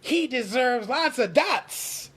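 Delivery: high, slow, sneering chant; mocking townsfolk. Wooden people judging Pinocchio, high sneer. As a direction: jeer at him from the crowd.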